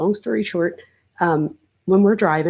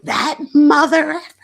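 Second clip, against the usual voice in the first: mock yell at the other driver, higher and louder; hammed-up road rage; 7 semitones higher, 6 dB louder than usual. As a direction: put on a big fake road-rage show. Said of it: "I put on, like, a show like no other".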